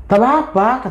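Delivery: high indignant retort; acted outrage from a wife refusing bad news; angry. A narrator's reading. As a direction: snap back in disbelief, pitch jumping up. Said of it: "Marah ibu ini".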